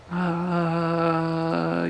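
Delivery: long, drawn-out "uh" of hesitation; clueless filler noise; stalling clerk. Retold or imitated. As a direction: imitate a clerk stalling on one held sound. Clerk stalls before putting caller on hold.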